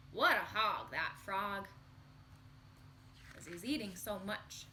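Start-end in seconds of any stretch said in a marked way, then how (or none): 0.1-1.7 mock disgust. Teacher comments on greedy frog.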